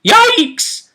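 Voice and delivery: high-pitched cartoon panic yell; Shaggy yelp. Game master mimics a panicked character fleeing the dead, cartoon yelp. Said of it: "Shaggy mo-, mode".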